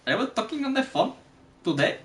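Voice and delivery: high, unconvincing Indian accent; dubious Indian accent. Shown as a demonstration. Trying accents on a laughing friend, shaky attempt at Indian accent. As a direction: badly fake an Indian accent. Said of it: "I don't think that's Indian".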